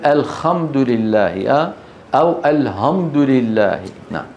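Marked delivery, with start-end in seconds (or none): 0.1-1.5 الخمد with a rasped kh in place of ح. Shown as a demonstration. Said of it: "إبدالها خاء أو هاء عند غير العرب". 2.5-3.8 الحمد with a plain h in place of ح. Shown as a demonstration. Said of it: "إبدالها خاء أو هاء عند غير العرب".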